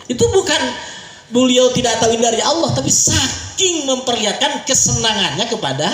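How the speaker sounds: ordinary talk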